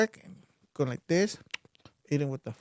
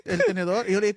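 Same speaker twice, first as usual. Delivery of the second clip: ordinary talk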